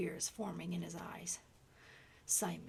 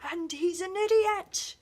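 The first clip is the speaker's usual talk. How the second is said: furious roar, far higher and louder; enraged Duke roaring at the nurse; 12 semitones higher, 13 dB louder than usual. Acted. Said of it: "Hastings roared".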